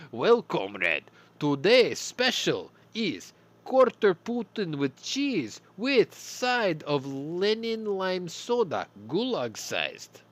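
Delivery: put-on loud, high, slow hammy Russian accent; bad Russian accent. Soviet cashier pitches the Putin burger in a bad Russian accent. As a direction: oversell the specials in fake Russian. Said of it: "a really bad Russian accent".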